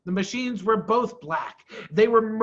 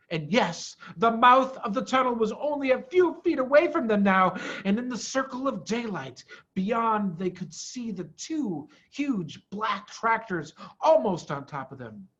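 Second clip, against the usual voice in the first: usual speaking voice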